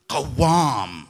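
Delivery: Qawwam said with a stressed doubled w, falling; teaching the Arabic word Qawwam, each sound clear; Arabic term Qawwam. Shown as a demonstration. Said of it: "this word is what we call mubalagha".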